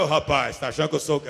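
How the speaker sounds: loud, low-pitched mock challenge; acted indignant rebuff. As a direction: play the offended man pushing back loudly. Acting out the man's offended rebuff.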